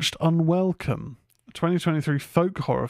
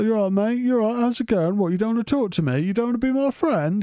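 high, mocking yob voice, much higher; taunting chav lout; 9 semitones higher than usual. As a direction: needle him like a lairy lad on the stairs. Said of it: "trying to sort of get in his face".

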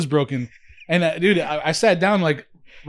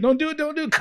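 high pleading chair; 11 semitones higher, faster than usual. Pretend. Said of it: "It's like".

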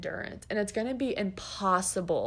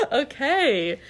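high laugh and laughing okay; 11 semitones higher, 4 dB louder than usual. Real, not acted. Trainer laughing after ranting about fake throws, laughing. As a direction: laugh at own rant.

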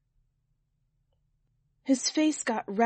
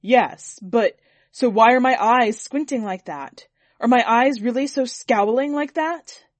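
much louder, furious demands; raging; 3 semitones higher, 10 dB louder than usual. A narrator's reading. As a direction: seethe and shout at the painter. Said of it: "Sir bristled with rage".

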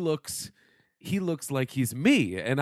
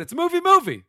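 announcer voice; 9 dB louder than usual. Pretend. Goofy announcer bit begins.

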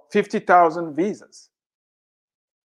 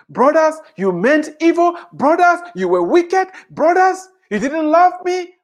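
Joseph rebuking; 7 semitones higher than usual. Retold or imitated. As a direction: preach Joseph's rebuke with rising force. Voicing Joseph rebuking brothers.

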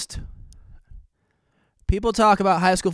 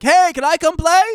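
high, loud call across the beach; 13 semitones higher, 8 dB louder than usual. Retold or imitated. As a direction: shout it to the players. Retold call to beach players.

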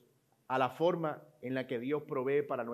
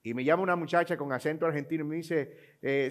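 usual speaking voice

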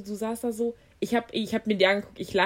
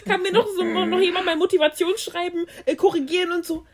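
mock panic; 6 semitones higher than usual. Mocking a panicked plea for help.

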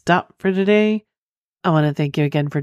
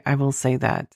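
ordinary talk

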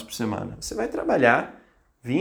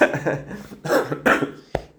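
real dry coughing fit. Real, not acted. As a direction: cough hard mid-talk. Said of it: "eu começo a tossir".

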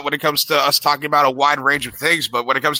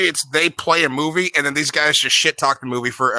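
normal talking voice

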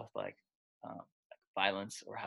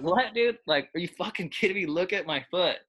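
high, loud disbelieving yell; incredulous outburst; 7 semitones higher, 5 dB louder than usual. Retold or imitated. Injured player answering doctor.

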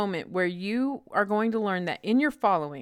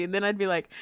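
ordinary delivery

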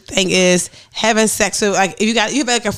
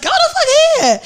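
much higher, fed-up brush-off; high dismissive snap; 3 dB louder than usual. Retold or imitated. Woman mimicking herself ditching a friend, dismissive. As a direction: shoo her away.

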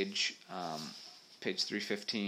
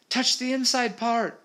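high, urgent instruction; shouting; 10 dB louder than usual. A narrator's reading. Molly urging Peter to use the locket, shouting. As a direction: call it out at a high pitch. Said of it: "shouted Molly".

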